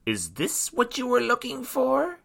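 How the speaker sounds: very high, taunting villainess; high-pitched wicked queen; evil queen. Acted. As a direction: taunt them in a high, gloating voice. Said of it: "laughed the evil queen".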